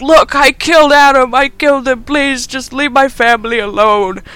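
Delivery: high, loud, hammed-up begging; mock desperate pleading. Doctor begs Zepp to spare his family, frantic mock pleading. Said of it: "and he's like".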